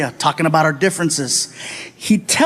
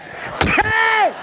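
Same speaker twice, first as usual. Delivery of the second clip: high-pitched alarmed "hey"; panicked yell. Acted. Passenger to driver heading for a red light, panicked yell.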